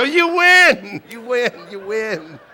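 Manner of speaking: loud, very high cry of defeat; mock surrender shout. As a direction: holler it in comic capitulation. Father gives in, acted loudly.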